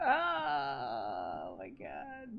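exhausted laugh. Real, not acted. Quiet weak laughter.

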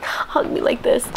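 strained, whispery mimed hug; demonstrated tight squeeze. Retold or imitated. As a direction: squeeze the words out as if hugging hard.

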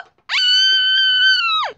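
sustained, very high scream. Pretend. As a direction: hold a long play scream. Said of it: "What are you screaming for".

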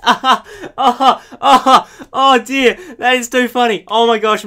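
high, loud laughing fit at own joke; real helpless laughter; crying with laughter. Real, not acted. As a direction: crack up at your own punchline. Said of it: "I'm actually crying".

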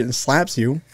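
ordinary delivery

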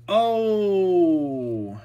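sudden high, loud gasp of surprise; real surprise, high and loud; surprised gasp. Real, not acted. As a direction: caught off guard by a rare card. Surprise at the next card.

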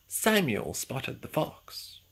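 plain reading voice

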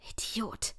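soft, breathy curse to herself; muttered curse. Acted. After hanging up she curses to herself, under her breath. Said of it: "schimpfte ich vor mir hin".

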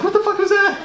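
imitated high-pitched startled shriek; hammed-up alarm of a woman startled awake; startled outcry. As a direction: yelp it in shock, very high. Startled woman jumps out of bed.

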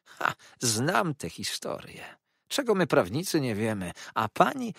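a cornered schemer lashing out; unctuous villain turned spiteful; Uriah Heep. Acted. Uriah threatening the company.

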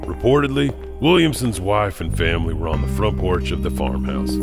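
usual speaking voice

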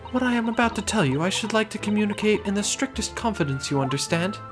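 high-pitched refined lady. Acted. Client asks for secrecy.

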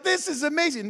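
high, loud awe; thrilled. Real, not acted. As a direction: burst out with wonder. Thrilled by miracle.

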